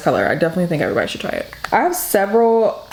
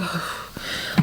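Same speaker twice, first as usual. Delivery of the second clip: long sulky exhale; huffy sigh; 9 dB quieter than usual. Retold or imitated. Sulking daughter huffing at her mother after being told no, huffy sigh. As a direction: sigh like a pouting teen. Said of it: "I'm pouting, I'm huffing".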